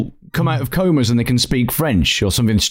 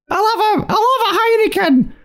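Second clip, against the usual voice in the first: very high mock Scottish voice; Billy Connolly. Shown as a demonstration. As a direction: squeak out a bad Scottish accent. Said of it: "It tends to go a really bad Billy Connolly".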